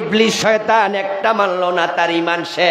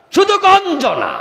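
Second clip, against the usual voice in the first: very high, held cry; cried-out warning; 14 semitones higher, 4 dB louder than usual. Real, not acted. Preacher warns of disgrace alone, crying out. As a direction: call it out high, then let it fall.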